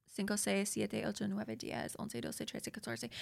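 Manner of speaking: soft, self-conscious recital that sinks toward a murmur; fading Spanish counting. Shown as a demonstration. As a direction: count on in Spanish, trailing away softly. Rattles off Spanish numbers, fading.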